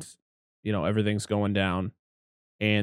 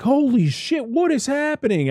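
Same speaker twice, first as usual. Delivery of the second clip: much higher, stunned exclamation; mock shock. Viewer reacts to Pops shooting John, in high mock shock. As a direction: gasp it out, way up high. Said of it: "And you're like".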